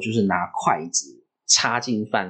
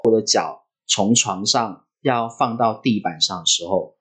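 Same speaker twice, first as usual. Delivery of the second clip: ordinary talk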